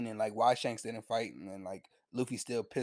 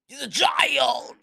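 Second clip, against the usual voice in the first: high, slow pleading cry, much louder; desperate shout; 14 semitones higher, 10 dB louder, much slower than usual. Retold or imitated. Old man shouting to Higuma to spare the boy, desperate pleading cry. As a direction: stretch out the cry, begging for the kid.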